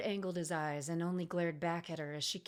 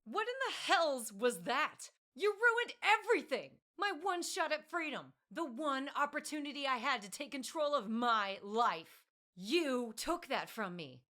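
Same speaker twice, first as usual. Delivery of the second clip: furious tirade; 7 semitones higher than usual. Acted. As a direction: tear into him for ruining everything. Elf rages at the birdman.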